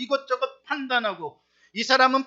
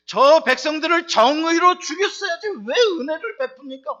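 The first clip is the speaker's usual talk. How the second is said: higher, louder indignant protest; angry Jonah; 6 semitones higher, 7 dB louder than usual. Retold or imitated. Preacher voicing Jonah raging at God's mercy, angry and loud. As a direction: spit out Jonah's outrage at grace.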